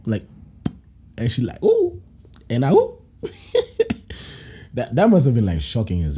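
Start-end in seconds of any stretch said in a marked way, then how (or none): 1.6-2.0 a falsetto ooh, much higher, hammed up; mock high ooh. 2.7-3.0 camp up the shock; mock high ooh.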